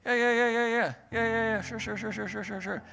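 dismissive brush-off. Retold or imitated. Brushing off his buddy about training, airy yeah-yeah. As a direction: wave off the question.

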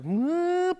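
high squeal then loud, slow; cartoonish screaming husband; mock yell. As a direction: ham up a sudden blow-up. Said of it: "and I just, like".

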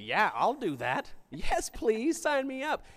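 high, eager and keen; eager yes-man. Retold or imitated. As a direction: jump at the offer like a keen simpleton. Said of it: "a simple bugger that would be like".

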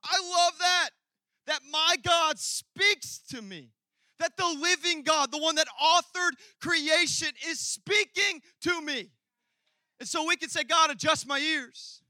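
high, loud, impassioned proclamation; full-force preaching. Real, not acted. Preacher proclaiming that God speaks to him, high loud preaching. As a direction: belt it out with fervor.

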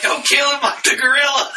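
high, loud shout through laughter; laughing plea. Retold or imitated. Begging the zookeepers not to shoot him, laughing shout. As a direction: beg them not to shoot, cracking up.

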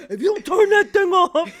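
loud, high, hammed-up fed-up holler; mock angry yell. Wife fed up with his alarm, mock furious yelling. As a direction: holler it like a fed-up partner, in fun. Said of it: "Punch him right in the leg".

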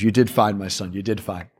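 soft, low, fatherly murmur; gentle papal reassurance. Retold or imitated. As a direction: softly reassure him like a kindly pope. Pope gently reassures the priest.

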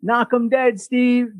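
half-yelled cheer from the crowd; retold yell. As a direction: imitate someone yelling encouragement to a performer. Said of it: "someone yells".

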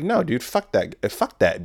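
ordinary delivery

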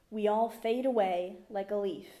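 usual speaking voice

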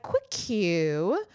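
imitated anxious question, pitch climbing very high; high, tentative mock-nervous voice; nervous dentist. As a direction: tiptoe into the question as the worried dentist. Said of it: "why does this man look terrified for his life".

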